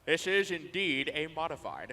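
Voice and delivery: lightly voiced languid art snob; low pretentious murmur; art critic impression. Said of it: "my art critic impression".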